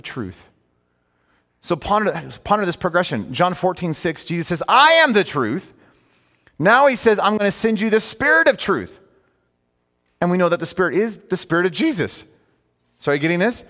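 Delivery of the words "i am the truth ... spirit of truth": declared from the pulpit; emphatic preaching. Acted. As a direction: lean on every word. Preaching scripture quotes.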